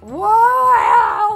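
loud, high whoa; freaking out. Retold or imitated. Wife sees husband's handwriting on letter, high loud whoa. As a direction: gasp it out in shock. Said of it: "freaking out".

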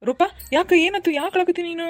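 high, loud anxious questions; alarmed concern. Acted. Husband finding his crying wife, alarmed and loud. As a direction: rush in, alarmed, to ask why she cries.

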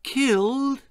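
high, gasped disbelief; Bill gasps in shock at the news. A narrator's reading. Bill hears the news, gasping in shock. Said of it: "Bill gasped with shock".

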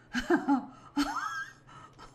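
high laugh tipping toward a cry; laughing despite the guilt; loud giggle with a whine; helpless laugh. Real, not acted. Laughs at harming cow.

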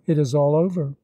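ordinary delivery, nothing marked

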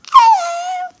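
very high, loud squeal; a piercing, put-on puppet hello; attempted character voice. Voicing Joe for viewers, shrill put-on voice. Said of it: "Sorry, that's not his voice".

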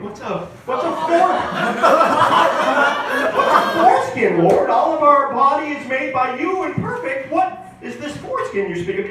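high-pitched, incredulous questioning; baffled questioning. Acted. Man asking the Lord what this unknown thing is, baffled. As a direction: stammer it out, high and bewildered. Abraham questions God's strange command.

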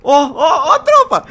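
high, loud excited yell; excited shout. Real, not acted. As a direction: call out the crew in disbelief. Shocked at a message.

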